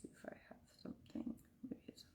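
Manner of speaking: barely audible whisper to herself; muttering to self. Real, not acted. Streamer checking whether she has something on her phone, whispered to herself.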